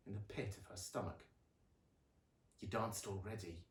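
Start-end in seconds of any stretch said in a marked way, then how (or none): 2.6-3.6 a low soft snap; quiet snap. A narrator's reading. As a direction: Luna snaps at her family, low soft snap. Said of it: "she snapped".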